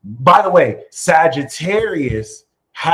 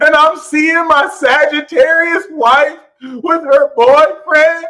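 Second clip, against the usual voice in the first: mock outrage; 13 semitones higher, 9 dB louder, slower than usual. Real, not acted. Joking about Sagittarius wife.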